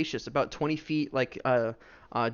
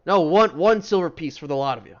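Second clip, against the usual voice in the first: loud, higher dwarf correcting a price; insistent dwarf; 3 semitones higher, 7 dB louder than usual. Acted. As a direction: push the correction out as a dwarf merchant.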